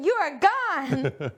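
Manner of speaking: very slow, drawn-out mock. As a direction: stretch it out teasingly. Mocking boyfriend's goodbye.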